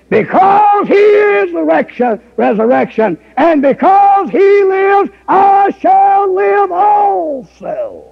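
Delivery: high, slow, drawn-out preaching; preaching at full force. Acted. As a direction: stretch each word in a high cry.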